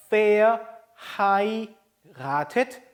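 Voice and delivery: Verheiratet slowly, syllable by syllable, starting "fair"; f-sound in Verheiratet. Shown as a demonstration. Teacher models the word for learners, slow f-initial Verheiratet. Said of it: "요거는 발음이 F죠".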